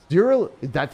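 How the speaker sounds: sudden high loud burst; startled gamer shout; excited yelp. Real, not acted. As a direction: blurt it out in disbelief. Shocked at enemy's health.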